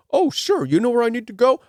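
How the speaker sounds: mock-naive traveller voice. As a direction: play the trusting fool. Mocking his gullible self.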